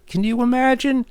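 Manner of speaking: sudden high squeak of disbelief; mock-scandalised rhetorical question; incredulous aside. Real, not acted. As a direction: toss it off like you can't believe it. Incredulous aside about jealous friend.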